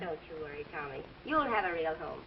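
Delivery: low, very soft comforting voice; hushed, gentle promise; tender reassurance. Acted. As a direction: soothe a child quietly. Comforting the wistful child.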